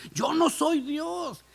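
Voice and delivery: a hint of tears as he insists; tearful. José weeping before his brothers, mild tearful plea. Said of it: "comienza a llorar".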